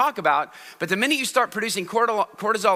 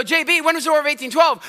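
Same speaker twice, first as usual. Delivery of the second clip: higher-pitched teacher's question; teacher calling on pupil; 8 semitones higher than usual. Acted. Teacher quizzing a startled pupil, higher-pitched call-out. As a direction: put the pupil on the spot. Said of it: "And so I go".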